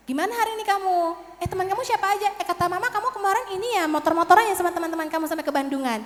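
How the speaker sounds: high, quick, sociable questions; friendly chatting. Acted. Counselor asking a pupil about friends, friendly chatting. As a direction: draw the child out with high-pitched chatter.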